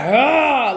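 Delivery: loud, high "Ah!" as he lands a hit; mock battle yell. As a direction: bellow like he's feeding off the blow. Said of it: "he would be like".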